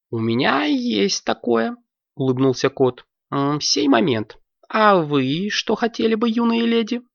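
lightly voiced courteous cat host; a mild, hospitable waiter cat; a touch of a polite cat.

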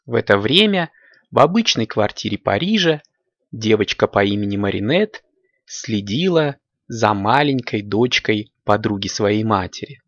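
ordinary talk, nothing marked

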